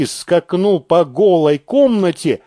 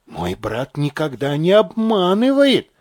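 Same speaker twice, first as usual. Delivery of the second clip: ordinary delivery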